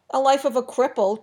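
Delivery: taunting. A narrator's reading. Trademaster mocking Enar to Gabe, loud jeering taunt. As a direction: sneer it out to wound. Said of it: "Trademaster taunted".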